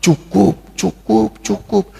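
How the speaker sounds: lightly acted plea to stop; pleading wife. Wife pleading with workaholic husband. (sedikit memohon dengan nada tinggi)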